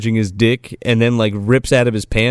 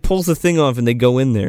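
normal talking voice

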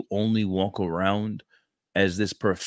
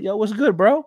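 hyped casual greeting; 13 semitones higher, 7 dB louder than usual. Retold or imitated. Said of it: "I was like".

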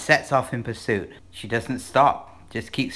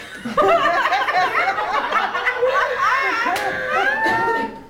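very high laughing; shrieking laugh. Real, not acted. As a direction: laugh till nearly crying. Laughs at own joke.

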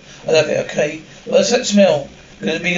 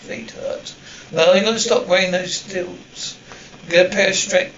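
ordinary delivery

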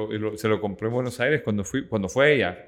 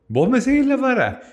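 incredulous, higher and louder; surprised; 12 semitones higher, 7 dB louder than usual. Real, not acted. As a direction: throw it back in disbelief. Incredulous echo of partner.

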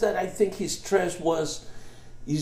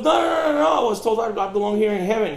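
high, loud, fast protesting yell; indignant shout; 5 semitones higher, 5 dB louder, much faster than usual. Acted. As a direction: shout your protest at the gate. Politician protests to Saint Peter.

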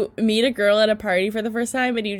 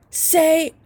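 higher, slow, emphatic pep-talk command; imitating a hype-up; 5 semitones higher, 4 dB louder, slower than usual. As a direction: hype your friend up with swagger. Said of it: "You were like".

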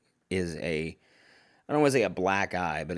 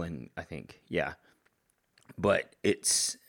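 usual speaking voice

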